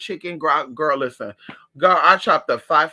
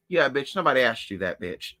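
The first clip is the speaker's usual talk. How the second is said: ordinary delivery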